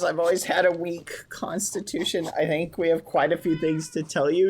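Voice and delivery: normal talking voice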